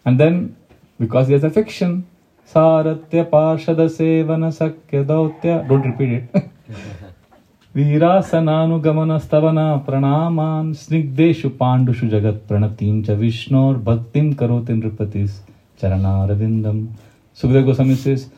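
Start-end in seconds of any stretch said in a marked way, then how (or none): 2.5-5.7 slow, measured chanting of the verse; Sanskrit verse recitation. Acted. 7.8-16.9 Sanskrit verse recitation. Acted.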